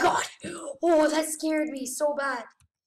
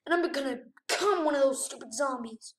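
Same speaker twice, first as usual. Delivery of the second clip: ordinary talk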